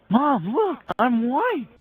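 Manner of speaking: very high, mock-gleeful child voice; excited kid. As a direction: show mom your discovery like a thrilled little kid. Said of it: "He's all excited".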